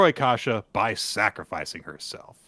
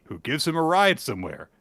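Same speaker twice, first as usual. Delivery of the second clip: ordinary talk, nothing marked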